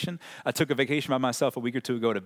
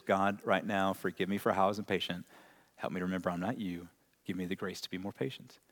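quiet prayer; 4 semitones lower, 5 dB quieter than usual. Retold or imitated. Quoted prayer for patience.

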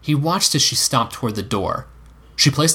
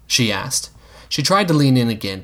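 ordinary delivery

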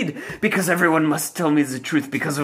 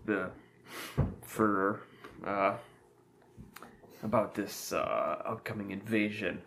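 much lower, quiet voice for Job; 9 semitones lower, 7 dB quieter than usual. Acted. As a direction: switch to the second character, low and soft. Playing Job character.

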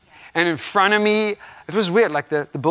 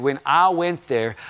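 ordinary talk

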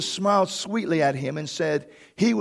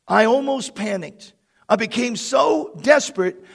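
ordinary talk, nothing marked

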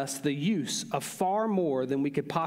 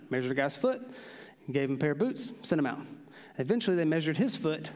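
ordinary delivery

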